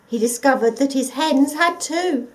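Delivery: usual speaking voice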